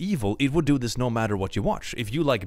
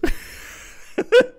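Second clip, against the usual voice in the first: airy squeal of a laugh; a high wheezing laugh; high breathy laugh; 7 dB louder than usual. Real, not acted. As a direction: burst into a high unvoiced giggle. Laughing at own joke.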